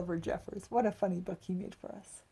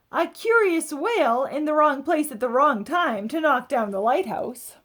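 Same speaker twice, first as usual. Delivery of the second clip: expressive read-aloud storytelling; picture book narration; 6 semitones higher, 14 dB louder than usual. A narrator's reading. Adult reading a picture book to children, lively storybook voice. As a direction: tell it like a bedtime story for kids.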